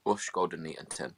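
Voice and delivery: quiet murmured fake Dutch; mock Dutch. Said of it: "Go on, speak some Dutch".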